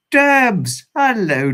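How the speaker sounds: high, loud delighted call of a name; thrilled to see her in the chat; excited greeting. Real, not acted. Greeting a favourite viewer excitedly.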